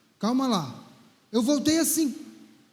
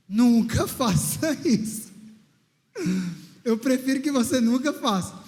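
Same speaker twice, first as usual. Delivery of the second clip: earnest warning; 3 semitones lower than usual. Real, not acted. Warning the audience, slow and low.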